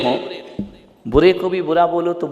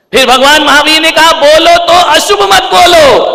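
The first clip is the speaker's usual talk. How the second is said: very high, loud preacher's cry; shouted proclamation; 10 dB louder than usual. Acted. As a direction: proclaim the commandment at full force. Proclaiming Mahavir's teaching to congregation.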